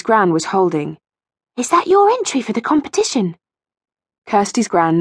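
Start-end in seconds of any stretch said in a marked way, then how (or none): 1.6-3.4 put-on high, curious girl character; young girl's voice. A narrator's reading. Rachel asks gran about the cake tin, girlish. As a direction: ask it high, like a curious child.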